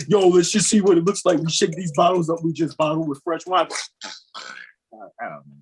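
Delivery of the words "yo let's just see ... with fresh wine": winery guy impression.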